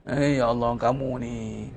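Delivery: put-on low, softened exclaim of a parent at a hurt child; fussing parent. Mother fussing at a child who fell, soft low exclaim.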